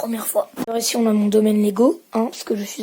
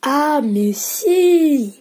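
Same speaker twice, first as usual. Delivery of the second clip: sudden realisation; 4 semitones higher, 3 dB louder, much slower than usual. Real, not acted. Sudden idea while thinking aloud.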